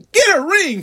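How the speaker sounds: ordinary talk, nothing marked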